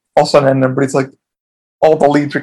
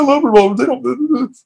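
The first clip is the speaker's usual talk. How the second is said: mocking gun fan; 10 semitones higher than usual. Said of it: "friends... they're like".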